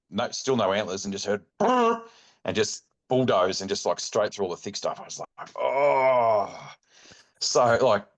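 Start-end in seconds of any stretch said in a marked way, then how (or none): 1.6-2.0 loud, high trilled burst; sudden sharp animal "brrr"; imitated stag noise. Imitates the startled stag's noise. 5.6-6.7 loud, drawn-out "oh" of dismay; long dismayed groan. Retold or imitated. Retells dismay as the stag bolts.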